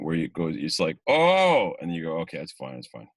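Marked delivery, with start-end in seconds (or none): 1.1-1.7 high, loud swelling "oh"; emotional outburst "oh". Retold or imitated. Actor hitting the emotion while practicing, overwhelmed "oh". 2.2-3.1 quick self-reassurance. Retold or imitated. Reins the emotion back in.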